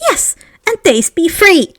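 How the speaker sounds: high, firm elf voice; firm house elf. Acted. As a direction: insist like a stubborn elf. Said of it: "nodded in firm agreement".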